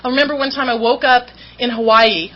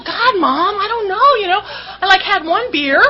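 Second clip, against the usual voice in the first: put-on innocent teen; 8 semitones higher than usual. Teen answering mom innocently.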